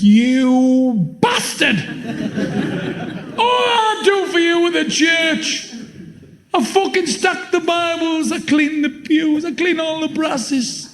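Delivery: high, slow shouted rant; furious yell at God. Acted. Injured David yelling at God, high slow shout. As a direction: scream it up at the heavens, outraged.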